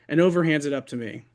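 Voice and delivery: usual speaking voice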